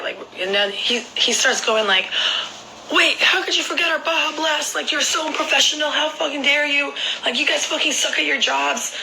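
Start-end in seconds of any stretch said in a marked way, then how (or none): 2.9-9.0 high-pitched mock rant at staff; outraged customer, acted out in a raised pitch; imitated angry yelling. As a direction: retell an indignant tirade without real shouting. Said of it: "yelling and screaming at the Taco Bell employees".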